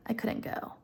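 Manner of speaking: whispered, sulky refusal. Retold or imitated. Wife refusing the ball invitation, whispered sulkily. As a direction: breathe it out sulkily.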